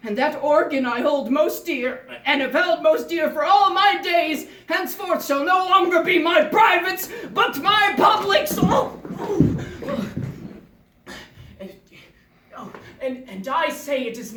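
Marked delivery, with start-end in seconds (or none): 2.3-10.1 hammed-up loud, high lament; anguished lament. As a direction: build to a wailing theatrical outcry. Condemned man laments his privates.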